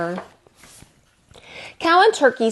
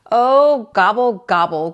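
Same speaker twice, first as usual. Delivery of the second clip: groan; 4 dB louder than usual. Acted. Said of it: "groaned Turkey".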